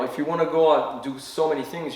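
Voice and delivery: ordinary talk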